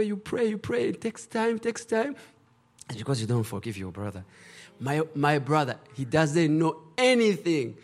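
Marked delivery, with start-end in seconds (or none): none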